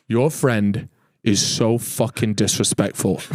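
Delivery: lightly voiced cab driver, slow and fed up; angry taxi driver. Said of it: "And he goes".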